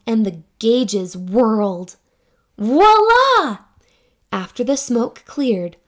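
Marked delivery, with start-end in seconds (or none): 2.6-3.6 much higher and louder grand announcement; triumphant voila. Acted. Scientists unveiling their new creation, triumphant. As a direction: present it like a magic trick.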